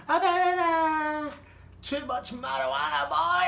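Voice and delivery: very high, loud, slow mocking sing-song; mocking boss. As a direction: taunt him right in his face. Boss mocking the stoned lad.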